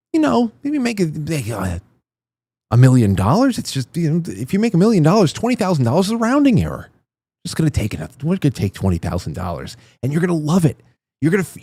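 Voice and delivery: sneering parody of his smooth pitch; mocking the politician. Host mocks the candidate's speech to viewers, sneering impression. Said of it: "The tone of his voice, the way he enunciates".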